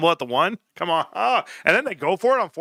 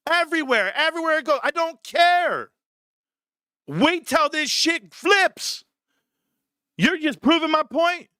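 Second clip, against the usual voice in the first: defiant rant; 8 semitones higher than usual. Real, not acted.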